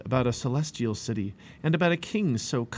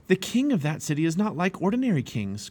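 higher-pitched, enthusiastic explaining; eager explanation; 6 semitones higher than usual. A narrator's reading. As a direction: explain with keen emphasis.

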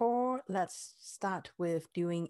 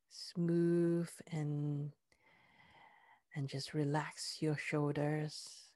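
soothing massage murmur; 3 semitones lower than usual. Acted. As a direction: lull the body loose, gently. Relaxation part of meditation.

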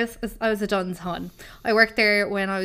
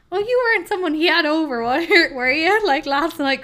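high, loud, teasing mother voice; knowing mother; 8 semitones higher, 7 dB louder than usual. Retold or imitated. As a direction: tease her with a knowing tone. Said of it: "she was like".

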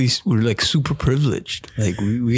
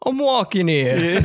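slow, drawn-out mock New York yell; hammed-up New York guy, laughing through it; New York accent; 6 semitones higher, slower than usual. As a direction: ham up a New York cabbie at a red light. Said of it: "we're like".

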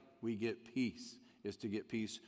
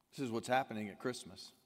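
usual speaking voice

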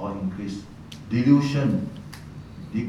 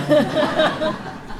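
short high laugh; 4 dB louder than usual. Real, not acted. Laughing at the story.

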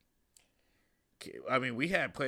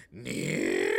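much lower gruff puppet voice; Grover near. Retold or imitated. Grover back at the camera, low and close. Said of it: "Come back up into the camera".

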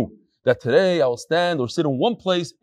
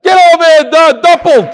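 loud, higher shout across the room; shouted call; 10 semitones higher, 10 dB louder than usual. Real, not acted. Rabbi shouting for the email writer to come forward, loud shout. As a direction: bellow his name to bring him up.

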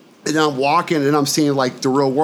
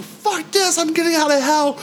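defiant; 12 semitones higher than usual. Retold or imitated. His own defiant thought.

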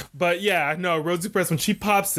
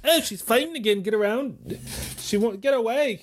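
put-on Australian accent; 9 semitones higher than usual. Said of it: "your Australian accent".

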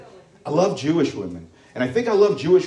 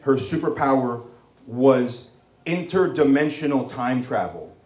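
ordinary talk

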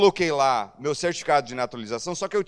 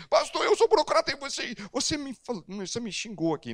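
offended listener; 6 semitones higher than usual. Retold or imitated. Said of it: "Depois alguém vem".

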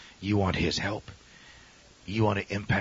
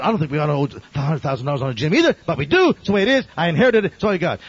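retold rising irritation, fast then louder and higher; getting steamed; 4 semitones higher, 8 dB louder, faster than usual. Pastor defending the gym debt to a stranger, getting steamed. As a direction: snap back, building in exasperation. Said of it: "I'm starting to get steamed".

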